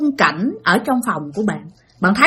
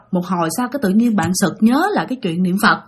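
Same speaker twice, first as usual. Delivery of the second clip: ordinary delivery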